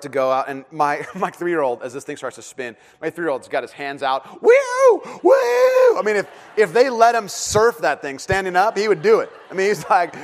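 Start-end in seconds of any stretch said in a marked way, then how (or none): 4.4-6.0 loud, high drawn-out whoop; gleeful child's woo. Retold or imitated. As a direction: whoop like a thrilled three-year-old. Three-year-old voiced, hands out.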